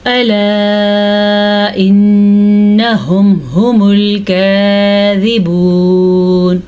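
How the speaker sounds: slow chanted recitation with nasal ghunna; tajweed recitation. Shown as a demonstration. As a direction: chant slowly, hold the ghunna. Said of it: "फिर से नून का गुन्ना अदा करना है". (गुन्ना के साथ धीमी तिलावत)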